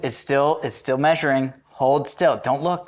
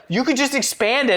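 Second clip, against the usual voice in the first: lightly voiced wife, pitched up; imitated wife; 8 semitones higher than usual. Husband retelling wife's ring advice, lightly imitated. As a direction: quote her in a higher voice.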